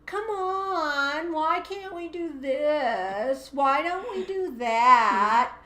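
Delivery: high, slow whine; whiny child voice. Shown as a demonstration. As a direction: plead and whine like a kid. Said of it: "That's the whiny voice".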